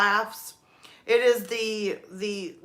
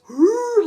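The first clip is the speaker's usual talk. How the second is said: wheezing laugh; 11 semitones higher, 8 dB louder than usual. Retold or imitated. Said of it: "having an asthma attack".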